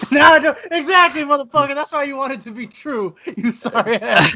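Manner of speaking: high-pitched, laughing call-out; laughing accusation. Real, not acted. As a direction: tease your friend through real laughter. Laughing, mock-accusing the co-host.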